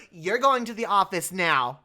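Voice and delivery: a hammed-up teacher sending a kid off; teacher ordering a kid to the office, slow and loud; stern teacher. Teacher voiced in story.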